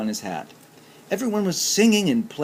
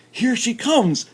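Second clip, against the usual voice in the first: shout; 11 semitones higher, 5 dB louder than usual. A narrator's reading. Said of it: "shouted Duck".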